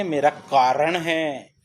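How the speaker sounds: कारण drawn out, firm final ण; slow and deliberate on कारण; correct word said slowly. Shown as a demonstration. Teacher stressing the right word कारण. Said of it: "कारण नहीं होता".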